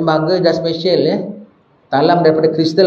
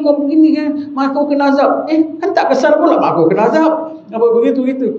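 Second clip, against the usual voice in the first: high teasing taunt, higher throughout; mocking taunt; 9 semitones higher than usual. Friend taunting the angry rival about his mother, high and teasing. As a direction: needle him, pitched high and gleeful.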